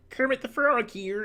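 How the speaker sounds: high puppet-frog voice; Kermit the Frog. Acted. Streamer showing Kermit before lowering it, high puppet voice. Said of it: "Kermit the Frog, you know".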